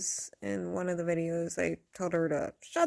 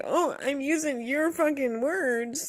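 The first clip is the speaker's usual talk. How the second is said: a snide mock of the other creator's voice; mocking quote; 6 semitones higher than usual. Mocking the creator who claims her words, in a snide voice. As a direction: sneer it back at her. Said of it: "sit there and say".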